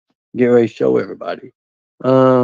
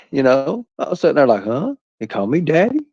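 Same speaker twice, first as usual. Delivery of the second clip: ordinary talk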